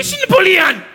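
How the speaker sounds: very high put-on mentor voice; lightly voiced wise old teacher; imitating the karate master. Karate-Kid-Lehrer zitiert. (Karatemeister nachgemacht, sehr hoch)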